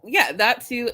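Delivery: ordinary delivery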